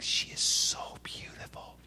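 hushed, awestruck whisper; overwhelmed whisper. Retold or imitated. Husband gazing at his wife across the table, overwhelmed whisper. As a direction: whisper it as if overcome. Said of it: "I'll be overwhelmed".

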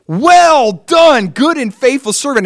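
high, ringing praise from the Master; Jesus praising. Acted.

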